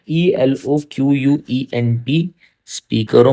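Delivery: each letter said apart, slowly; spelling out letters. Shown as a demonstration. Teacher spells the word for students, letter by letter.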